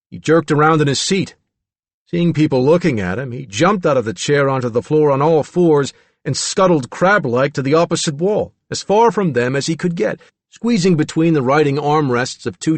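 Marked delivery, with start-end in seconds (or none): none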